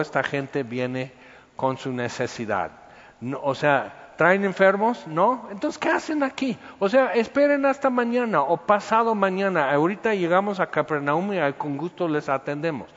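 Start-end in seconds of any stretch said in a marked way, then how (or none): 4.2-12.1 high, exasperated grumble; irritated disciples shooing the crowd away; annoyed disciples. Retold or imitated. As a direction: brush them off, fed up.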